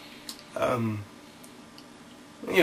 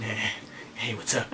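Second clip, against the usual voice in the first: much softer, lower creepy greeting; creepy dude; 6 semitones lower, 13 dB quieter than usual. Retold or imitated. Imitating Yamazaki, soft and creepy. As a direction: purr it like a creepy stalker. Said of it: "that dude is so creepy".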